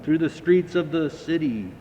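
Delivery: plain reading voice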